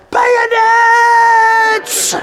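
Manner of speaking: huge high shouted cry; imagining Jesus shouting to the troops; yelled command. Retold or imitated. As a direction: scream it like a rallying order. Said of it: "Jesus is like".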